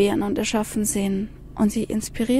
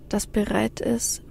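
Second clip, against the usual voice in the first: usual speaking voice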